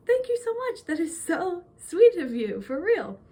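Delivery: high, drawn-out heartfelt thanks; gushing gratitude. Retold or imitated. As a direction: re-enact accepting a compliment, starting high and easing down. Acting out gracious reply to compliment.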